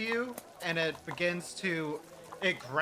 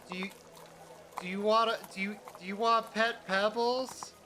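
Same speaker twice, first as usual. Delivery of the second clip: put-on higher, halting child voice; shy young boy; 4 semitones higher than usual. Boy offers his rescuer the cat, high and halting. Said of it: "twist his heels and says".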